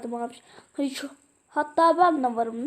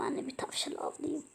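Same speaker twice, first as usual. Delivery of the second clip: whispered, sneaking in for the hunt; 14 dB quieter than usual. Acted. Fox stalking a rabbit, to himself, whispering. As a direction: hush it so the prey won't hear.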